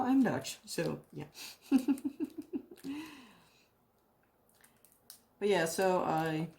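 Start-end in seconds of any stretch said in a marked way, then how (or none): 1.3-3.1 embarrassed giggle. Real, not acted. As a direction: giggle sheepishly at yourself. Laughs about being Dutch.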